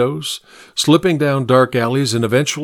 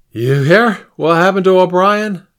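high unsteady man calling out; calling after; 9 semitones higher, 5 dB louder than usual. A narrator's reading. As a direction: call out after him, voice unsteady. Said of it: "the unsteady man called after him".

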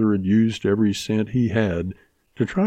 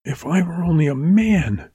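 higher, mild bitter edge; a hint of clenched anger; gritted; 8 semitones higher than usual. A narrator's reading. Mary vowing revenge on the rustlers, mildly gritted. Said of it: "she gritted".

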